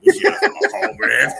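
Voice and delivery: high laughing squeal; laughing mock line. Teasing friend, high laughing squeal.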